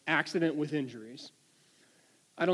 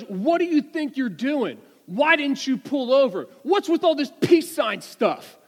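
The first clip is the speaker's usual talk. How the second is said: loud, high-pitched mock scolding; angry trooper; 7 semitones higher, 6 dB louder than usual. Trooper confronting the driver he pulled over, angry. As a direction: imitate an irate officer berating a driver. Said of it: "Trooper Jack was not happy at all".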